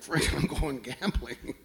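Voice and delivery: a touch of a slow, soft, bored grumble; bored husband. Retold or imitated. Renee bored of the show, slow and low. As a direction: drag it out, fed up. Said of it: "He's like".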